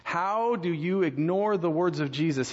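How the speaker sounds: ordinary delivery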